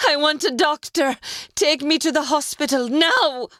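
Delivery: high, loud desperate shout; screaming demand. A narrator's reading. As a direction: yell for the hospital. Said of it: "she screamed".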